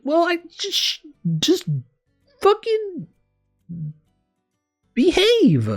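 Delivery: very high, loud, halting exclamation; exasperated outburst. Real, not acted. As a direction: burst out in fed-up disbelief. Exasperated retort to characters.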